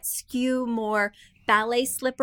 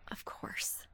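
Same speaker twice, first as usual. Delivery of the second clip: much softer, whispered repeat; breathy echo whisper; 17 dB quieter than usual. Real, not acted. Host whispers a resigned echo over the jackhammer. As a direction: let it fade to a whisper.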